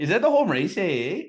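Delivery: playful exaggerated Canadian, high and loud "eh"; mock Canadian accent. Co-host joins the joke about Canada, mock Canadian accent. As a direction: ham up the Canadian "eh" for laughs. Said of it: "I think we should just talk like this".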